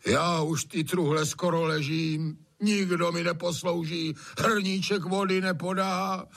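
high, tearful self-pitying whine; whining lament. A narrator's reading. Master angles for Anče's pity, high put-on lament. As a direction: squeeze out a tear while wailing. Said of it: "naříká, slzu z oka mačká".

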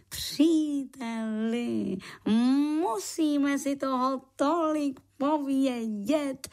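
high, slow, drawn-out exclamation; joyful call. Acted. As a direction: exclaim with joy, stretching the words. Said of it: "radostně zvolal". (radostné protáhlé zvolání)

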